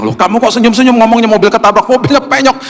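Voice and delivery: high, incredulous wife; puzzled wife. Retold or imitated. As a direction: ask it high and baffled. (istri yang heran, suara tinggi)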